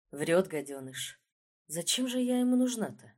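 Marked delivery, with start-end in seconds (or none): none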